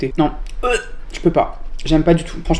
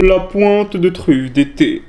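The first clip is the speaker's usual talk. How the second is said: put-on slow, higher posh Versailles accent; 4 semitones higher, much slower than usual. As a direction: drawl it out in a haughty Versailles accent. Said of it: "il est temps de prendre l'accent de Versailles". (accent bourgeois de Versailles, lent et traînant)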